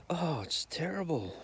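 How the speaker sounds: put-on a touch of a high old nana tut-tutting; mild mock old-lady dismay; lightly voiced old lady. Old nana at the dinner table, lightly voiced and dismayed. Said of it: "the old lady goes".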